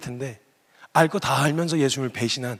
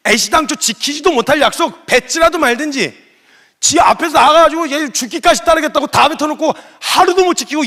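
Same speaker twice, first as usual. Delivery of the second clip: much higher and louder, scolding in disbelief; conscience yelling; 8 semitones higher, 9 dB louder than usual. Retold or imitated. Peter's conscience screaming at him on the beach, loud and scornful. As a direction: berate yourself as your own conscience. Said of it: "지 양심이 소리를 질렀을 거라는".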